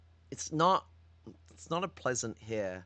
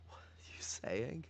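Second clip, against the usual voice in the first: disbelieving whisper; 12 dB quieter than usual. Real, not acted. Reacting to Jason's quote.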